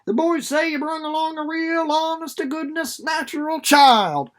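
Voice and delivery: high, eager rustic man's voice; an excited country husband; eager old farmer. A narrator's reading. Tuck voiced in read-aloud.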